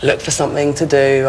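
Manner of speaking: normal talking voice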